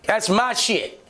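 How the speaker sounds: cocky warning. Retold or imitated. Taunting cop searching car.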